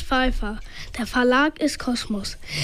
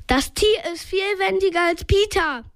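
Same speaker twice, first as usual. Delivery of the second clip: higher-pitched called remark; storyteller's mildly raised cry; calling out; 5 semitones higher than usual. A narrator's reading. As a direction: call it over to a friend. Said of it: "rief Bob Justus zu".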